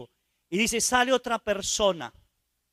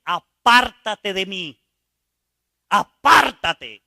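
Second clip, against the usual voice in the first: loud, slow pleading cry to be left; anguished cry; 9 dB louder, much slower than usual. Retold or imitated. A sinner crying out to God to leave him. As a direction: push God away in shame, loudly. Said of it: "Y le dije".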